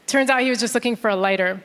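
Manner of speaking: ordinary talk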